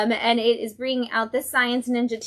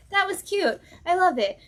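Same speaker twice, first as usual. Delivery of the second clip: pleased comic fan; 9 semitones higher than usual. Retold or imitated. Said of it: "as a comic book fan, you're like".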